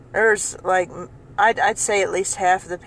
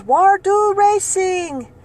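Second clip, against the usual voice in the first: high, slow, exasperated broken English; angry and confused; 12 semitones higher, 5 dB louder, much slower than usual. Retold or imitated. Angry customer repeating her question to the cashier, high and slow. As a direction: mimic her frustrated repeat. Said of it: "so then she says".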